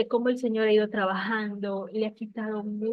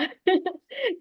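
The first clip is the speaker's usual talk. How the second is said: high, breathy little laugh; emotional laugh; 9 semitones higher than usual. Real, not acted. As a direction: laugh through being moved. Moved by mother's tears.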